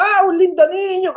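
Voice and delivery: high-pitched mock exclamation of a delighted doctor; imitated joyful cry. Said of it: "el médico gritaba". (imitando el grito alegre del médico, en tono agudo)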